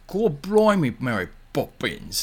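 hammed-up bad Cockney, high start then drawn out; mock a dreadful Cockney accent, slowing on the name.

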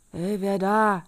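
loud, challenging call; sudden loud "who goes there". A narrator's reading. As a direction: shout a challenge to an intruder. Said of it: "rief er".

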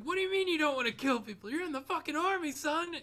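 high, loud, rapid tirade; drill sergeant. Retold or imitated. Drill sergeant to recruit who won't kill, fast yelling. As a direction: imitate an outraged drill sergeant tearing into a recruit. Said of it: "the drill sergeant's like".

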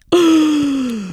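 high startled gasp; sharp gasp. Retold or imitated. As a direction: suck in a breath in shock. Discovering open hamster cage.

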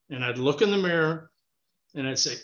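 ordinary talk, nothing marked

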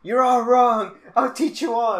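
high, loud mock tirade; retold yelling. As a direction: imitate a student raging at a teacher. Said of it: "he starts yelling at him".

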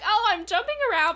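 high, loud, fast excitement; imitating breathless glee; excited. Voicing B's excited jumping.

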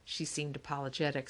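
ordinary delivery

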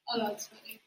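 ordinary talk

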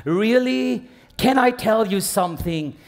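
high, impassioned sermon cry; preaching at full force. Acted. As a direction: build into a ringing exhortation.

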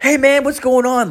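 high-pitched, eager buddy greeting; upbeat buddy. Acted. Long-lost buddy phones to say hello, upbeat. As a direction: imitate a pal calling out of the blue.

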